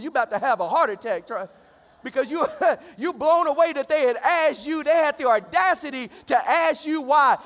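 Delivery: loud, high, worked-up sermon delivery; full-force preaching. Real, not acted. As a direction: whip up the congregation with comic outrage.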